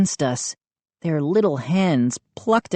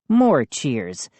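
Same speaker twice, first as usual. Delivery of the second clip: plain reading voice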